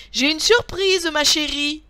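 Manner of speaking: thrilled husband calling out; excited announcement. A narrator's reading. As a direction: announce a gift to your wife. Gardener calls out to wife.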